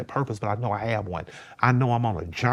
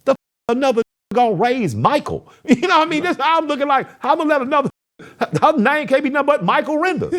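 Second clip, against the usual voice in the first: heated; 6 dB louder than usual. Retold or imitated. Heated defense, laughing.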